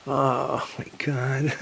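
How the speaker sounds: normal talking voice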